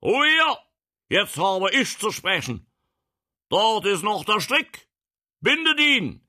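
very high, loud, imperious shout; roared command. Acted. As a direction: silence the uproar and take command. Prince silences crowd, orders binding. (herrisch und lautstark gebrüllt)

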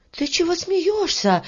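high-pitched indignant question; angry girl. Acted. Varya rounds on the laughing beetle, angry. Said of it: "рассердилась Варя".

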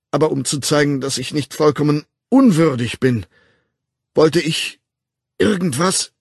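impassioned, halting vow; fervent declaration. A narrator's reading. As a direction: declare yourself in urgent, broken phrases.

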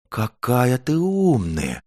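slow, high awestruck praise; marvel, stretching every word; admiring. A narrator's reading. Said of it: "с восхищением сказали медвежонок и зайчонок".

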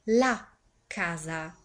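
drawn out very slowly, heavy with dread; slow, portentous echo of the house; slow ominous repeat. Acted. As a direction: let each word hang, like bad news sinking in.

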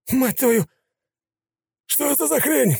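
very high panicked shout; terrified yell. A narrator's reading. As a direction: scream in shock at the creature. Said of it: "заорал Ника".

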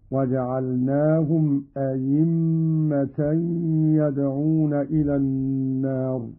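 أئمة said ayimma, with a full y sound; hamza as pure ya. Shown as a demonstration. Teacher shows the alternative reading, أئمة as ayimma. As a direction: replace the second hamza of أئمة with a clear "y". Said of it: "إبدالها ياء خالصة".

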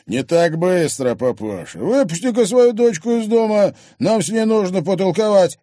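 loud, high bullying order; menacing demand. Acted. Thug blocking door, demanding the daughter, menacing. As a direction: order him about with rough force.

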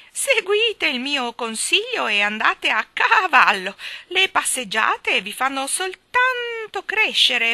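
high, loud, slow hen-like squawk; eccentric old lady urging. Acted. Miss Calico urging the children to ride, high loud slow squawk. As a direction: crow each order at them.

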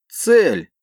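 high loud bark. Acted. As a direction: bark out the alert. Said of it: "рявкнул другой оперативник".

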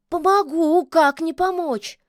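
high, loud eager agreement; high-pitched keen kikimora; eagerly willing. A narrator's reading. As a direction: pipe up, ready to help. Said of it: "с готовностью кивнула Кикимора".

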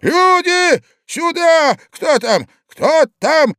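high, loud calls for help; old man shouting. A narrator's reading. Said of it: "закричал старик". (старик громко зовёт на помощь)

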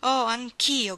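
woman crying out in relief to her lover; relieved cry. A narrator's reading. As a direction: burst out in relief. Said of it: "gridò lei con un profondo sospiro di sollievo".

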